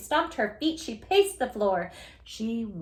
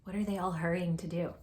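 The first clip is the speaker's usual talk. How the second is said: usual speaking voice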